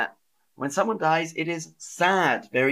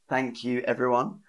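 normal talking voice

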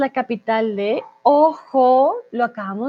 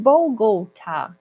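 "Bogotá" with a foreign gliding "bow-go" vowel; slow foreign-accented "Bougotá"; foreign-accented mispronunciation; much slower than usual. Shown as a demonstration. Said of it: "esto es por el acento".

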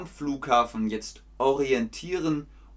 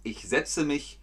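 normal talking voice

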